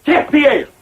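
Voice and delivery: grand mock-French announcement; fake French accent. Host introducing the chef to guests, fake French accent. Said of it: "I forgot my French accent".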